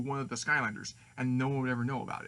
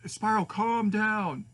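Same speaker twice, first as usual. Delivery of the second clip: high, slow, stretched-out order; raised, drawn-out plea; urgent command; 8 semitones higher, 4 dB louder, much slower than usual. Acted. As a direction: call it out firmly to someone panicking. Friend tells him to calm down.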